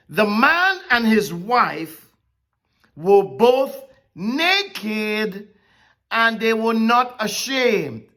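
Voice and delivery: slow reading with each word stressed; preacher pressing the words of the verse; emphasized reading. Shown as a demonstration. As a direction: lay weight on every word, slowly. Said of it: "emphasizing these words".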